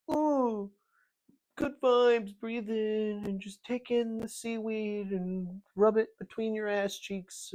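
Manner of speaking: high airy mocking yoga-teacher voice, slow on the breathing; mock hippie yoga voice. Host mocking the yoga tribe as airy hippies. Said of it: "being like".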